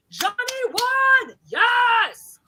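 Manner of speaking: high, loud cheer of delight; cheering for a viewer's win; excited cheer. Real, not acted. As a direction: shout it like a win. Cheering a donation name.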